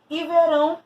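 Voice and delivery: verão said high and loud; verb verão stressed. Shown as a demonstration. Teacher contrasting the two meanings of verão, emphatic. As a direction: set verão apart as the verb. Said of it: "de ver, visão".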